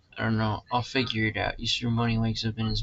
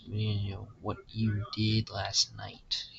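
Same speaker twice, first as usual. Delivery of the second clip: slow ominous read; 5 dB quieter, slower than usual. Pretend. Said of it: "testing dialogue line".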